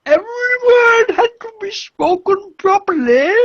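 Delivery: high, loud, over-enunciated English aunt; mock refined English aunt enunciating; posh enunciating aunt. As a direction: enunciate every word like the prim English aunt. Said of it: "my aunt used to enunciate every word".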